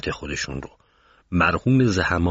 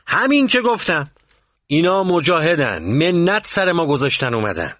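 raised, high-pitched voice, overruling him; angry insistence; 10 semitones higher, 4 dB louder than usual. A narrator's reading. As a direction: shut down the objection forcefully. Angry commander overrules the official's objection.

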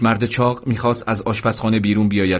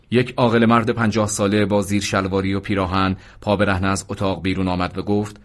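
ordinary delivery

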